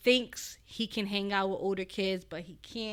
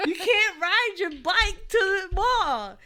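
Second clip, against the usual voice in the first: firm scolding mom; 10 semitones higher, 12 dB louder than usual. Retold or imitated. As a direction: put your foot down hard. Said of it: "And I said".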